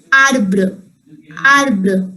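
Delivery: "arbr" with the final E reduced after br; French pronunciation demo. Shown as a demonstration. Teacher showing how A R B R E is pronounced, careful "arbr". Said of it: "R E is pronounced as".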